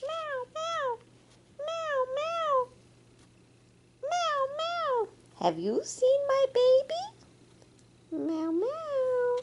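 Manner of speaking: high little cub meows; baby jaguar meows. Acted. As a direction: mew like a hiding cub.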